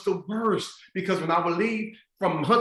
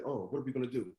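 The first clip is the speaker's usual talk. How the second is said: much softer, lower mock despair; hushed worry; 5 semitones lower, 11 dB quieter, faster than usual. Speaker imitating teachers when covid hit, hushed and worried. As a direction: let it sink under the worry.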